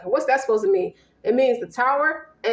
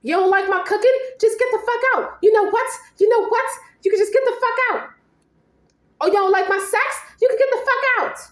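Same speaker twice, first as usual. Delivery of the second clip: much higher and louder, shouting to throw them out; angry spouse kicking the other out, yelling; furious yelling; 10 semitones higher, 3 dB louder than usual. Acted. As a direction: scream at the partner to get out. Voiced partner yelling in argument.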